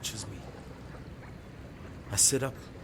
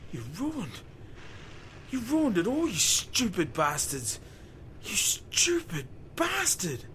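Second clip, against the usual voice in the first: anguished outburst; 7 semitones higher, slower than usual. Acted. Harold rages at rig crew.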